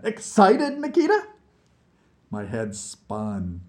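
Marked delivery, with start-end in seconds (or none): 0.0-1.2 high, loud, rising question; grinning tease. Acted. Vlad goading horrified Nikita about the trip, grinning and excited. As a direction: needle him gleefully through a grin. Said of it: "Vlad grinned".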